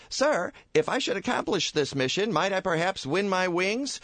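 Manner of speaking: high, meek little voice; timid angel Clarence. Acted. Angel Clarence answers his boss, meek and high. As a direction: ask it shyly, eager to please.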